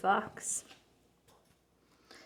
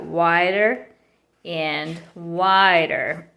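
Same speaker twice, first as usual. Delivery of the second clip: slow it way down for suspense; drawn out; 5 semitones lower, much slower than usual. A narrator's reading. Narrator building suspense as her eyes widen, slowly. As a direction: stretch "and wider" very slowly.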